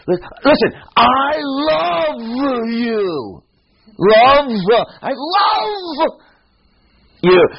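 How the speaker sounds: "love" held very high and long, ending in "love-uh"; mock-impassioned preacher stretch on "love"; emphatic added syllable. Shown as a demonstration. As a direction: wail "love-uh" high and drawn out to insist. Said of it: "I wanna emphasize".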